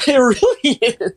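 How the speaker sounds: laughing while talking. Real, not acted. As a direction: laugh through the words. Laughing agreement.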